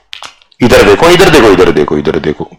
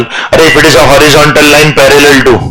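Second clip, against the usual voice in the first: surprised exclaim; 7 semitones higher, much slower than usual. Real, not acted. Teacher exclaims at wrong answer.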